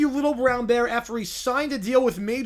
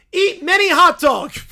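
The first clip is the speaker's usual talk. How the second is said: loud bear voice; 8 semitones higher, 9 dB louder than usual. Acted. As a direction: bellow it like a lovesick bear.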